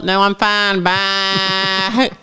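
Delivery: put-on old Southern woman bleating like a goat; elderly Southern neighbor. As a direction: stretch "Bye" into a high goat bleat. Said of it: "we just sound like livestock".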